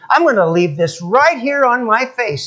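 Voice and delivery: building to a high, loud shout; mock-outraged man yelling after a bird; comic indignation, stretched out and climbing; indignant shouting. Acted quote of man cursing seagull.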